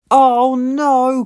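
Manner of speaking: high, loud cry of dismay; choked by coal dust; coughing dismay. A narrator's reading. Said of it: "coughed Percy".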